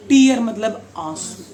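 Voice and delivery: टीयर said as teer, long ee; tear said teer. Shown as a demonstration. Teacher showing the class tears say टीयर. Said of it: "इसको टीयर नहीं बोलते".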